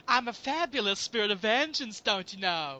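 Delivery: high, lilting camp voice; hammed-up flamboyant avenger; camp spirit of vengeance. Host plays a fabulous Ghost Rider, camp.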